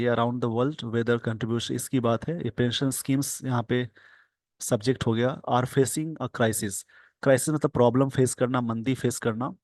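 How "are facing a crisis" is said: slow dictation. Shown as a demonstration. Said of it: "slow dictation reading".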